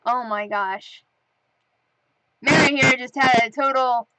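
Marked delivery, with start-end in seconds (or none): none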